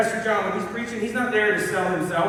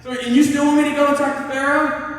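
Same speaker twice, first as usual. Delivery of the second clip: incredulous Moses; 6 semitones higher than usual. Retold or imitated. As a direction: protest it like a joke. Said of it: "I'm like".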